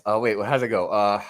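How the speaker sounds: ordinary talk, nothing marked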